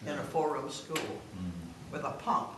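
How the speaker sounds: usual speaking voice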